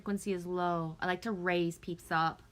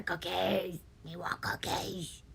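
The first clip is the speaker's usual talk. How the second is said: Cookie Monster voice; 4 dB quieter than usual. Pretend. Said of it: "I'm speaking like Cookie Monster".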